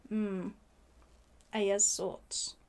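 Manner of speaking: low, hushed sceptical aside; reserved aside. Real, not acted. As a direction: drop your voice to hint at private doubts. Sceptical aside after quoted view.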